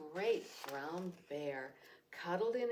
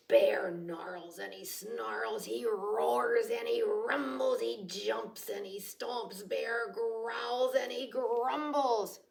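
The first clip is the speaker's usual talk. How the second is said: growly narration; 2 semitones higher, 7 dB louder than usual. A narrator's reading. Narrating the angry bear.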